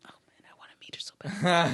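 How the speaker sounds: an unvoiced, longing whisper. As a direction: whisper it to yourself, longing to meet her. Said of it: "I'm like".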